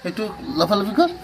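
put-on high, loud baby talk to a pet; high coaxing pet voice. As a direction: coax the cat to play. Coaxing the cat to play.

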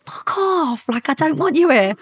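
high, drawn-out inner outburst; retold fed-up outburst, rising in volume; acted exasperation. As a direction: swear in despair at an unwanted tagalong. Retold exasperated thought at dealer.

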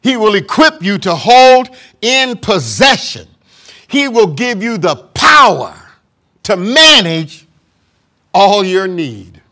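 slow, ringing preacher cadence; sermon delivery building in fervor; preaching. Acted. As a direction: preach it out, drawing each phrase long. Preaching at full force.

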